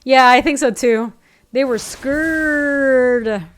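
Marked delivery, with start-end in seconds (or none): 1.5-3.5 drawn-out mock scared. Repeats line drawn out.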